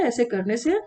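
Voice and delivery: ordinary delivery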